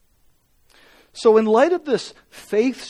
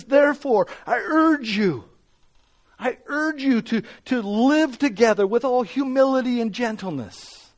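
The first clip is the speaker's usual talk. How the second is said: impassioned preaching; 6 semitones higher than usual. Acted. Preacher voicing Paul urging the church, fervent and pleading. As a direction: plead with the congregation, building urgency.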